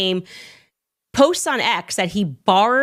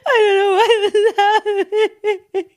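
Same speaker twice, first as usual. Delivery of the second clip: very high, hammed-up sobbing and laughing; fake-weeping starlet filming herself, wailing; mock crying celebrity; 12 semitones higher than usual. Mocking a celebrity crying on Instagram, high fake sobs. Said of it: "Think about the steps there".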